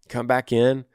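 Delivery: usual speaking voice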